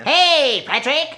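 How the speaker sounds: high, loud cartoon sponge call; excited cartoon sponge greeting; SpongeBob voice. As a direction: yell it like a squeaky cartoon sponge. SpongeBob impression in banter.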